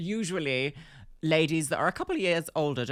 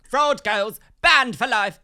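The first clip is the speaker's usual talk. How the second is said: loud verdict; 8 semitones higher, 9 dB louder, slower than usual. Acted. Playful verdict.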